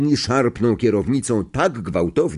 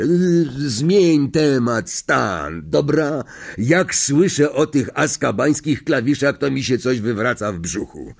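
uneasy driver; 6 semitones higher than usual. Acted. Driver shudders and stops Stan's gossip, uneasy. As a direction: plead reluctantly to drop the subject.

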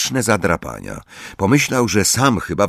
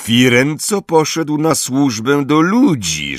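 slow, high, severe accusation; the grey centaur accusing Firenze; stern grey centaur; 9 semitones higher, 4 dB louder than usual. Acted. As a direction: condemn the traitor coldly. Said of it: "rzekł szary centaur".

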